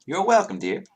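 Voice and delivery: high, quick friendly barmaid; chirpy tavern barmaid greeting. Acted. Barmaid answers.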